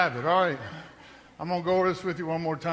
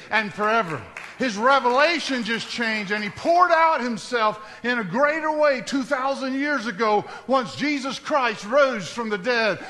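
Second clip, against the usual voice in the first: loud, soaring preacher's call; full-force preaching; 5 semitones higher, 5 dB louder than usual. Acted. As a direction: preach it high and strong to the congregation.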